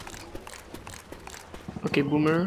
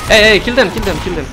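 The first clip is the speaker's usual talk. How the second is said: loud excited urging, higher; urging shout; 4 semitones higher, 6 dB louder than usual. Real, not acted. Player urging allies at enemies, loud urging shout. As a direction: egg the team on.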